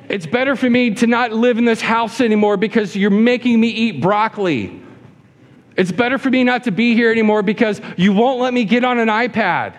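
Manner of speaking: put-on high-pitched whiny child throwing a fit; fussy baby. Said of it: "This is like a fussy baby".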